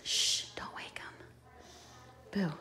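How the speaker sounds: hushed whisper, slow and soft. Acted. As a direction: whisper so you don't wake him. Mother hushing near sleeping toy.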